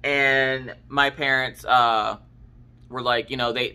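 normal talking voice

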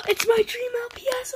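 high, loud and fast, bursting with joy; excited shriek over a gift; thrilled squeal. Real, not acted. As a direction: squeal it out, overjoyed. Sees her dream toy.

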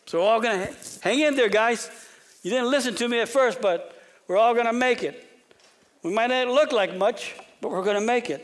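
rally the sailors, hang in there; preacher playing Paul cheering on frightened sailors; Paul rallying the crew.

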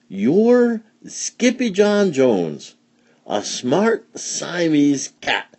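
high, slow, drawn-out insistence; emphatic mama. A narrator's reading. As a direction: spell it out slowly for a kitten. Mama lectures her kitten in story.

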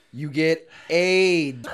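slow, stretched-out mock moral; deliberately slow comic verdict; drawn-out punchline. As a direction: drag out the last word for comic doom. Delivering the mock moral of story.

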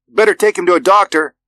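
put-on high, loud child's voice; young boy. A narrator's reading. Little boy urges father about hurt dog, high child voice. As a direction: pipe up like a small boy.

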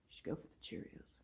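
quick, very soft whisper; whispered advice. Acted. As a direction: whisper a suggestion to someone indecisive. Second character advises the indecisive girl.

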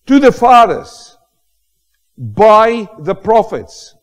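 full-force preaching. Acted. Preacher building up to the congregation, full force.

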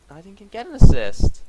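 loud, exasperated outburst at the game; frustrated shout. Real, not acted. Gamer robbed of an assist, shouting in frustration. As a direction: yell it out in disbelief.

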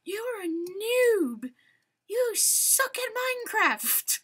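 slow, high mocking whine; mock heckler. Gamer imitating viewers yelling at him, mock high whine. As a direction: mimic an annoying chat heckler. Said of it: "you guys won't be screaming at me".

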